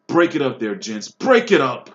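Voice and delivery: loudest shout. A narrator's reading. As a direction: roar over the whole crowd. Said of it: "louder than all".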